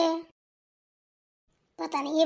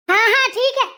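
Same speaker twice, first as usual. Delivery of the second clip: loud, higher, brushing it off; loud grudging yes; 4 semitones higher, 9 dB louder than usual. Acted. Mother-in-law giving in to the bahu, loud and grudging. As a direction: snap a grudging agreement and move on.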